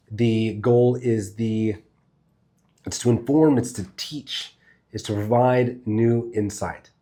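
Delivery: slow, deliberate teaching delivery; slow down so each point can land; slowed educator pace. Shown as a demonstration. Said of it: "I immediately began speaking this way".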